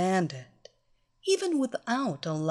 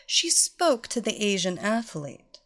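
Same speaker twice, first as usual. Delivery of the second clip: ordinary delivery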